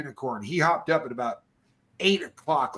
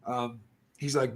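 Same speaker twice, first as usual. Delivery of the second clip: normal talking voice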